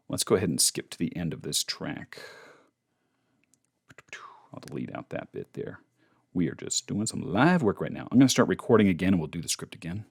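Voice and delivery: ordinary talk, nothing marked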